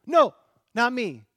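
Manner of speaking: loud, high acted denial; knee-jerk denial. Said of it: "his first reaction to say".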